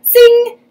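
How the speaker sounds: very high, loud ringing zing; zing shot. Acted. Magic pistol firing, high loud zing. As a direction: fire it like a magic ricochet.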